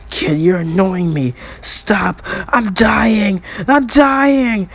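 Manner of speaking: hammed-up cry of dying; faked dying wail. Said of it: "the Sejun is just faking it".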